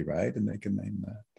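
ordinary delivery

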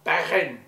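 loud "Bergen" showing off its Dutch sounds. Shown as a demonstration. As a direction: punch out "Bergen" loudly in Dutch. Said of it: "Can you hear it in there?".

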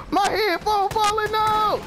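high, stretched-out wail; whining high-pitched alarm; panicked yell. Real, not acted. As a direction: cry out in a frantic fluster. Panic as headphone slips mid-game.

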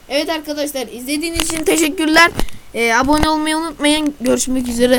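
loud, fast, upbeat youtuber outro; outro sign-off. Acted. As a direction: wrap up the video to viewers. (yüksek sesli enerjik kapanış)

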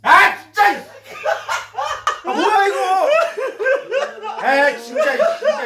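yelling. Real, not acted. Shocked, yelling and laughing.

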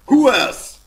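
loud, high challenge; combat yell. Real, not acted. Player calling out enemies in a fight, shouting. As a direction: shout it at the next enemy.

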